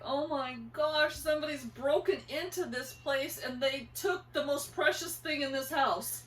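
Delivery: distressed mother reliving the discovery; retold panic. Mom finding the house broken into, panicked. Retelling her panicked reaction.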